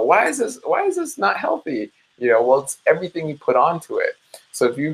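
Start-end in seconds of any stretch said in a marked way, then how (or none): 0.0-1.9 high squeaky puzzled whine; baffled whine. Retold or imitated. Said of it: "we go".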